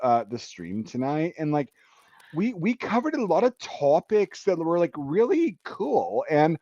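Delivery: gushing mock Valley Girl; Valley Girl accent. Shown as a demonstration. Thanking a guest on the stream, mock Valley Girl accent. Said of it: "the Valley Girl one is".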